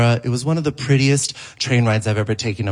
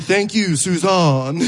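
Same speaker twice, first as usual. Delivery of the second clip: slow, higher, arch sign-off; mock sweet thanks; 4 semitones higher, slower than usual. To the host Susan, slow and arch. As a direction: give the name back with sly sweetness. Pointed mispronouncing of host's name.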